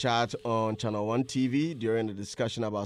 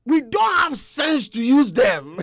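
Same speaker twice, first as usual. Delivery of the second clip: booming shout, slow and much higher; Bulldog impression; 13 dB louder, slower than usual. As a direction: yell it slowly like Bulldog, laughing. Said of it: "make I do my Bulldog impression".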